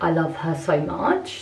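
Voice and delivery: normal talking voice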